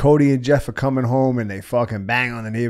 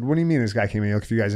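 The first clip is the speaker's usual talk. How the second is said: rattled off fast in confusion; fast disbelief; much faster than usual. Retold or imitated. Narrator answering the caller, fast and baffled. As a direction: fire it back quickly, baffled. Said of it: "I said".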